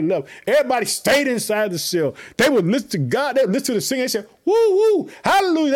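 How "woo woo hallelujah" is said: high, loud church whoops; jubilant whooping. Acted. Prisoners cheering the singing in jail, jubilant. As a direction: whoop like a rejoicing congregation.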